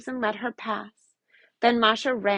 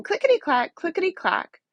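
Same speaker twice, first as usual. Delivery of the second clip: clickety-clack; 7 semitones higher than usual. Acted. Loom noise imitated.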